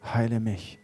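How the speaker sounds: soft, hushed prayer for the room to repeat; quiet led prayer. Acted. Pastor leading the congregation in a confession prayer, hushed and earnest. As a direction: pray it gently, line by line, for others to echo.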